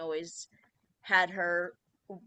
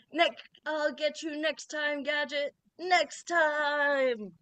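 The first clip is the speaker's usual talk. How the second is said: higher, louder cartoon villain threat; Doctor Claw; 6 semitones higher, 5 dB louder, slower than usual. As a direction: shout it like a scheming cartoon villain. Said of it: "A phrase that has remained".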